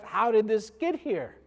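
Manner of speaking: high, loud incredulous question; retold outraged husband; mock indignant. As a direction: demand an explanation in comic disbelief. Confronts wife about the garlic.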